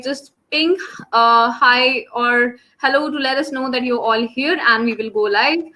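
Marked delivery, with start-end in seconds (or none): none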